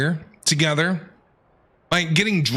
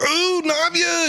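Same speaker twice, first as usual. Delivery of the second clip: higher, slow, thrilled gasp and cheer; excited gasp; 13 semitones higher, slower than usual. Real, not acted. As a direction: gasp with delight at a favourite character. Fan excited a favourite character appears.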